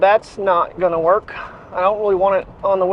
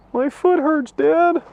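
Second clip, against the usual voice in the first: higher whiny puppy voice, complaining to Dad; voicing the dog; 8 semitones higher, slower than usual. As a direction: speak for the limping dog, pitched up and pleading. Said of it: "She's like".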